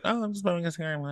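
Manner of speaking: high airy quoted voice; breezy mock innocence. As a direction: play it off as nothing. Mock casual self-quote.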